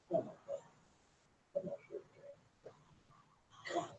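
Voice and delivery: whispered coaxing to a pet; whispering. Real, not acted. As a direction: softly whisper the cat over. Coaxing the cat softly.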